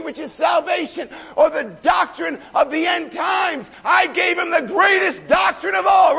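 high and loud, driving fervour; full-force preaching. Acted. Preacher firing up the congregation, full-force preaching. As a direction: preach it home to the crowd.

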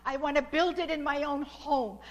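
ordinary delivery

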